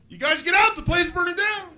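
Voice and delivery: high, put-on alarm call; mismatched-face guy. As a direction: warn of a fire in a strained high voice. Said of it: "doesn't know how to match his face".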